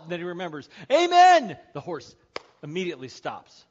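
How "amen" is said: very high, loud yell; desperate scream. Acted. Man remembers the horse's command at the cliff, desperate scream. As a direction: shout the stop word in panic.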